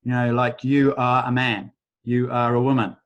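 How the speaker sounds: ordinary talk, nothing marked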